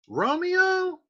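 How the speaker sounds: high incredulous Romeo?; mocking question. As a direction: scoff at the name as a joke. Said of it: "Scott's like".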